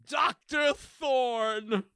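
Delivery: very slow, drawn-out mock British; British accent. Guessing a guest's British show, in drawn-out mock British accent. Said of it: "you can't say these words without British accent".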